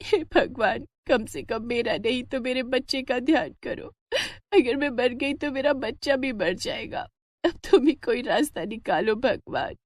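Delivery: weeping cow begging God to save her calf; tearful prayer. Acted. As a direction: plead quietly to God, worn out. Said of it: "इधर गौरा रोने लगती है".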